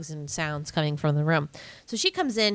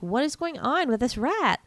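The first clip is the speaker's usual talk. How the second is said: ordinary talk, nothing marked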